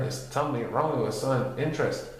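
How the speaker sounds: ordinary delivery, nothing marked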